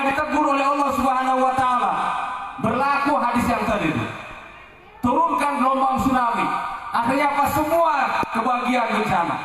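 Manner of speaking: impassioned preacher building to a peak; fiery preaching. Acted. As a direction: preach it hard, climbing up. Preacher rising to full force.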